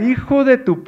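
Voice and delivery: high, loud Spanish scolding; mother yelling. Retold or imitated. Spooked mother curses her son fallen through ceiling, yelling. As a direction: shriek it like an outraged mom. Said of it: "as she's yelling at me".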